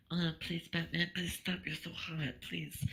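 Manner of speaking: high, whiny pleading as a begging Superman; mock pleading Superman. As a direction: beg like a hammed-up, swooning Superman. Superman begging Batman, retold.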